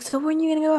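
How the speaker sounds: high, eager imitation of mom asking; excited mom. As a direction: mimic a mother keen to know. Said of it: "she was excited for me too".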